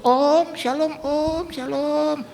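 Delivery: high mock-pious shalom, slow at the end; pious greeting. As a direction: suddenly turn holy and sweet. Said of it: "Jadi berubah". (salam saleh yang dibuat-buat)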